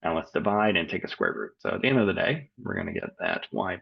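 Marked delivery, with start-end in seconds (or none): none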